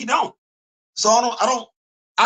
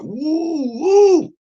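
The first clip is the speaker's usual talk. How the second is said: high, drawn-out woo rising louder; excited whoop; 12 semitones higher, 5 dB louder than usual. Real, not acted. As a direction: let out two high whoops, the second louder. Whoops after the dramatic passage.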